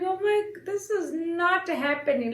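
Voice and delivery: high, wailed disbelief; mock bawling. Retelling her reaction to twin news, mock bawling. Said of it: "I start bawling out".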